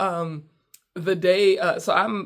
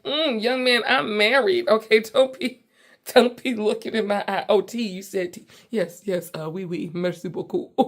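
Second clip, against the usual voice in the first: higher, louder sassy brush-off with a laugh at the end; sassy married lady; 3 semitones higher, 5 dB louder than usual. Retold or imitated. To a flirting French waiter, mock-stern and sassy. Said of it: "And I'm like".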